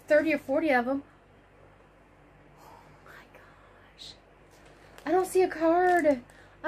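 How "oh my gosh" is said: slow, hushed whisper of amazement; barely audible, drawn-out whisper; awed whisper. Real, not acted. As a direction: whisper it slowly, overwhelmed. Awed at opening yarn package.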